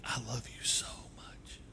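soft tender whisper up close; tender whisper to his daughter; gentle whisper. Real, not acted. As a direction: whisper it close to her ear. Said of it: "you use a gentle whisper when you're close".

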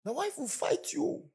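high, slowed cautionary voice; suspicious man. Retold or imitated. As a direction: warn her like a meddling relative. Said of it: "He said".

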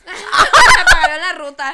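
loud and slow through laughter; slow, laughing jab; laughing punchline. Real, not acted. Friend mocks the bet with a laughing, slow punchline.

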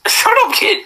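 high mock yelp; squeaky mock voice. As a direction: squeak it out at him. Mock scolding friend.

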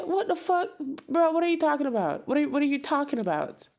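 high, loud mock shout of disbelief; imitating a shouting man. Ranting at the other man, loud mock shout. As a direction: play the outraged guy, loud and high. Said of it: "Man said".

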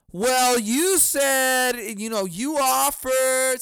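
high, loud, drawn-out retort; mock indignant mother. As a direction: imitate a mother's complaint, stretching each word. Retold mother's retort in argument.

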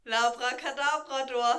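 loud, drawn-out punchline; punchline delivery. Pretend. Answering own dog joke for co-host, loud punchline. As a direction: belt out the silly pun slowly.

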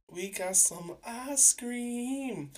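high, slow, sheepish confession; guilty reveal. Real, not acted. Telling listeners he ordered ice cream anyway, slow and sheepish. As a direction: stretch it out like owning up to a bad idea.

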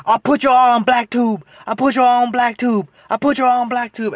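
imitating a drunk woman threatening; drunk lady threat. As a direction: repeat the threat like a broken record. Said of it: "She, she's like".

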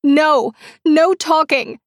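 high, loud panicked refusal; frantic captor. A narrator's reading. Woman refuses to let Dag speak, high frantic shout. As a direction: cut him off in a panic.

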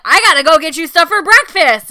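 loud, high-pitched imitation of Zach yelling; hungover partner yelling. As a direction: boom it out like a drunk too-cheerful partner. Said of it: "Why the fuck are you yelling".

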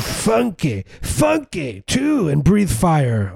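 very high sing-song mockery; mocking artist voice. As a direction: squeal it like a silly performer.

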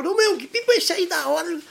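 put-on a young boy impressed by a kite; kid asking. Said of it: "a molecadinha chegava". (molecadinha admirando a pipa, voz aguda de criança)

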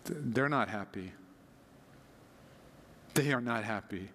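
normal talking voice

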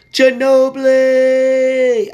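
drawn-out, high, loud Barkley call of Ginobili; Charles Barkley impression. Imitating Charles Barkley's drawn-out call for the listeners. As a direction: stretch Ginobili slow and loud like Barkley on air. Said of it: "Charles Barkley used to be like".

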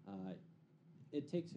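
ordinary talk, nothing marked

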